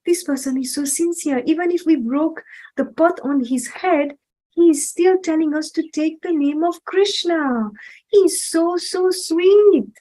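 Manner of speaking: put-on a touched villain telling the story to a child; awed Jagai. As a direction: marvel at his sincerity. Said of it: "And Jagai said".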